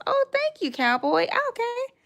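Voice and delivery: squealing thanks. Real, not acted. Thanking cowboy for gifts, very high excited squeal. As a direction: squeal with delight at the gift.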